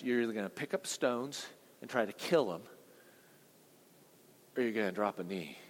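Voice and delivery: ordinary delivery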